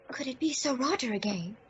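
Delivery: normal talking voice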